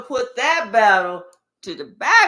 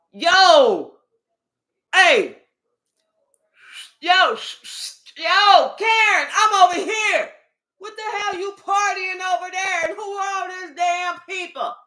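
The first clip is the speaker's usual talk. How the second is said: loud, higher calling out; yelling over the fence; 8 semitones higher, 4 dB louder than usual. Acted. Ex yelling across the fence at Karen, loud and high. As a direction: call across to get noticed.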